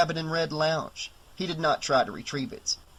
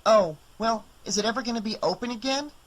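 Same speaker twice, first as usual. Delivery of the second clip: put-on higher kid's voice, polite; young boy; 7 semitones higher, faster than usual. Boy asks Ellie for the owner, higher kid's voice. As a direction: play a small boy asking politely.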